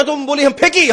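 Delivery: high, indignant South Indian challenge; mock-angry accusation. Angrier man accuses the trainee, high mock indignation. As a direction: demand it in hammed-up outrage. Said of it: "अब उनको और गुस्सा आया".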